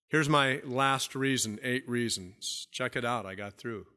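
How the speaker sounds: usual speaking voice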